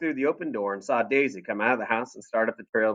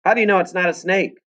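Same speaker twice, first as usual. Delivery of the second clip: shouted; 9 semitones higher, 4 dB louder than usual. Acted. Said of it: "Papa shouted".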